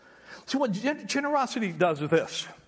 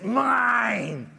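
demonic snarl, higher and louder; possessed; 4 semitones higher, 4 dB louder than usual. Retold or imitated. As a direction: growl it like a possessed child. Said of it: "you get that demonic voice".

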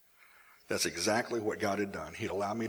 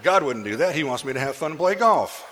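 loud, higher self-justifying protest; mock protest; 4 semitones higher, 10 dB louder than usual. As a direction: protest it loudly, pitch climbing. Mock protest in own thoughts.

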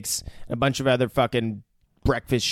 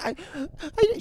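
timid woman; 4 dB quieter than usual. Retold or imitated. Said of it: "she's getting really timid".

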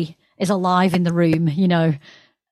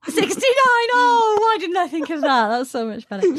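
amused laughing; 10 semitones higher than usual. Real, not acted. Joking about handle.

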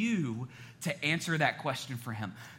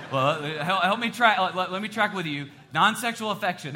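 ordinary talk, nothing marked

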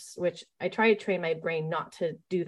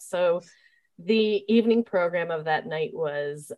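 ordinary talk

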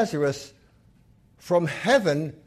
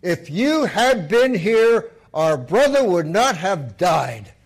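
scolding; 2 semitones higher, 8 dB louder, slower than usual. Acted. Sisters scold Jesus for not coming, slow loud reproach. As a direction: scold him for coming late. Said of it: "they scolded him".

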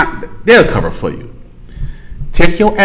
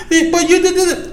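high whiny deflection; 9 semitones higher, 6 dB quieter than usual. Retold or imitated. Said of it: "He come up with a lie".

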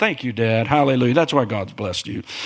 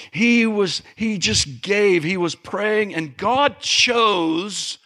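fervent preaching; 7 semitones higher than usual. Acted. Preaching rises in pitch.

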